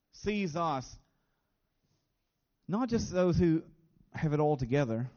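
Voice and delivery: ordinary talk, nothing marked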